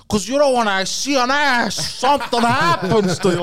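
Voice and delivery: high, loud freaking-out Pacino; Al Pacino impression. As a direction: yell it like a panicking Pacino. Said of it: "where he goes".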